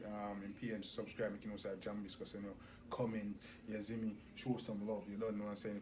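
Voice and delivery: ordinary delivery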